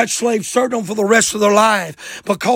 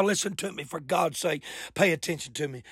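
much softer, low urgent aside; hushed plea; 4 semitones lower, 13 dB quieter, faster than usual. Real, not acted. Preacher begs church to pay attention, hushed. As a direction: drop right down and plead for attention.

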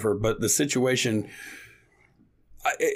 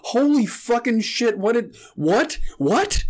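higher, louder, slow disbelieving what; stunned disbelief; 9 semitones higher, 3 dB louder, slower than usual. Retold or imitated. Host replays his stunned reply to the clerk, slow and higher. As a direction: drag it out, can't believe it.

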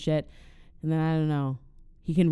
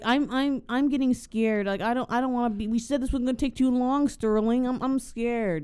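higher, stammering hammed-up fear; scared whine; 7 semitones higher than usual. [name] telling Sterling he's scared, stammering mock fear. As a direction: whimper it to your partner.

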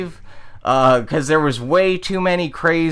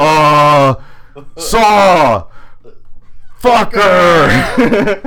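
dim singer voice; 4 dB louder, much slower than usual. Retold or imitated. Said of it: "And he's like".

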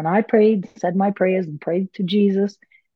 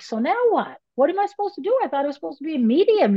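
bewildered question; 10 semitones higher, faster than usual. Retold or imitated. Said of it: "I'm like".

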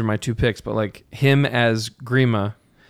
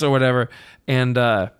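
usual speaking voice

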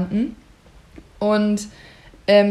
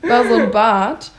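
ordinary talk, nothing marked

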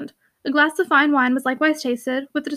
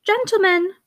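higher, silvery call; silvery tones; 6 semitones higher, slower than usual. A narrator's reading. Shirley calls to the men striking her dog, silvery call. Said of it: "was uttered in Miss Kildar's silvery".

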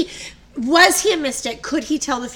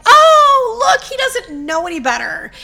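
mock condescension; 10 semitones higher, 8 dB louder than usual. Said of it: "they're just kind of like".

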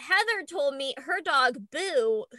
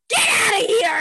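higher, louder yell at the dog; mock shout; 6 semitones higher, 7 dB louder than usual. Owner retelling yelling at her humping dog, loud. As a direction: shoo the dog off sharply. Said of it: "And I was like".

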